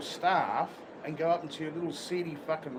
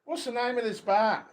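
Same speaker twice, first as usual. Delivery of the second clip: a raised, higher call of the question; half-shouting it over the noise; mock shout; 6 semitones higher, 6 dB louder than usual. Tourist asking the bartender, raised voice.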